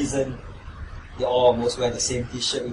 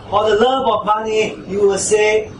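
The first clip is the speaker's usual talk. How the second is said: preaching louder; 6 semitones higher, 4 dB louder than usual. Real, not acted. Preacher builds to climax.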